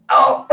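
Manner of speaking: very high, squealing Oh and laugh; dad fake-laughing too strongly at a neighbor's comment, shrieking; shrieked laugh. Overdone delighted reaction.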